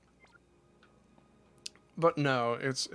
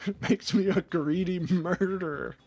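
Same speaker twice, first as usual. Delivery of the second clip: laughing; 6 semitones higher, 4 dB louder than usual. Real, not acted. Laughing at a narcissism accusation.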